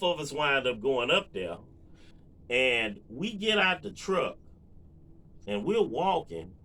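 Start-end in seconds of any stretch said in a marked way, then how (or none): none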